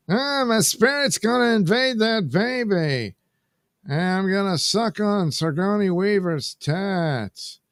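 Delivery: high, put-on spooky character voice; mock villain voice. Vlogger voicing a cartoon villain, high put-on voice. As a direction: ham up a possessed villain, high. Quoted figure with spirit invading baby.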